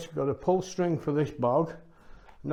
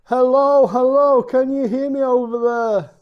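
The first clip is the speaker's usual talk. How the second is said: loud, high shout across the room; calling from far away; 9 semitones higher, 11 dB louder than usual. Real, not acted. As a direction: call out to someone far off. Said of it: "we'll shout to Dawn and see how far away".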